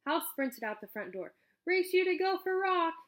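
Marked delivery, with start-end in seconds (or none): 1.6-2.9 much higher and louder, calling out a race; yelled challenge. Acted. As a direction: shout it back over your shoulder as you run off. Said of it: "he yelled".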